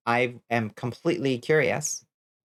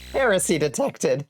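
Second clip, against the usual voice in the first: a higher mock fanatic's voice, HERESY punched; mock zealot; 5 semitones higher than usual. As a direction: play a zealot sounding the alarm, tongue in cheek. Repeats chat line in mock voice.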